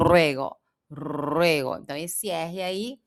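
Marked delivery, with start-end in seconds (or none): 0.1-1.8 ruego slow, R rolled at the front; trilled R. Shown as a demonstration. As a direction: roll the R in ruego.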